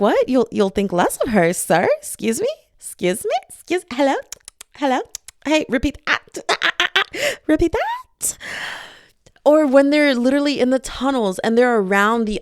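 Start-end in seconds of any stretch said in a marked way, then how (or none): 0.0-8.4 high, sassy mock outrage; indignant. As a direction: call him out like a diva. Indignant mock confrontation of hero.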